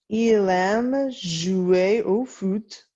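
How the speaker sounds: Il aime jouer au foot read slowly, each word set apart; slow read-along. Shown as a demonstration. Teacher modelling the French sentence for her class, slow and careful. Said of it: "try your best French accent".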